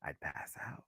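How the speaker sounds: breathless whisper. Real, not acted. Fan imagining [name] talking to her, whispered. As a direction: whisper it as if you might faint.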